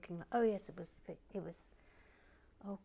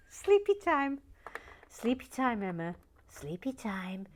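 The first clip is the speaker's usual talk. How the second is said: playful sing-song, teasing a friend to bed; 4 semitones higher, 9 dB louder than usual. Pretend. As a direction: tease her like a child at bedtime. Teasing chat member about bedtime.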